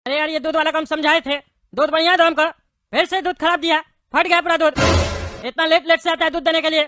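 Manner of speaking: loud, fed-up telling-off; angry scolding. Acted. Seth scolds the milkman for spoilt milk, loud and angry. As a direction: tear into him for the spoilt milk.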